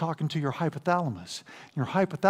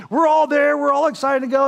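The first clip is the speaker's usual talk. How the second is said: louder, higher eager build-up; excited; 7 semitones higher, 5 dB louder than usual. Retold or imitated. Preacher recalls the group eager to raft, excited. Eager crowd before rafting.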